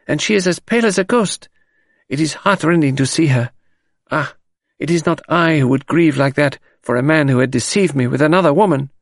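put-on high, lamenting French-accented maid. A narrator's reading. Maid gossiping about her upset mistress, high lament. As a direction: pity the mistress, pitched well up.

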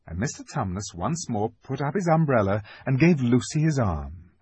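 ordinary delivery, nothing marked

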